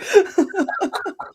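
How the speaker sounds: high-pitched giggling burst; laughter. Real, not acted. Reader recalling four names per character, laughing. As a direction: laugh at your own struggle.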